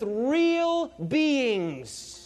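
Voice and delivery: very slow, stretched "real beings"; drawn-out emphasis. Real, not acted. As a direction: linger on each word.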